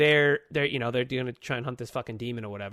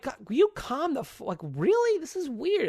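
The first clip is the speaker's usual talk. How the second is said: exasperated; 11 semitones higher than usual. Retold or imitated. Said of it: "But I was like".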